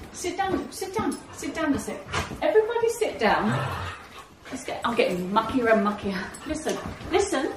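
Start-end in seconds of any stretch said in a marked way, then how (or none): 0.1-3.5 higher sing-song dog talk; coaxing the dogs. Real, not acted. Owner coaxes her whippets to sit, higher sing-song. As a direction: coax the dogs down onto the lounge.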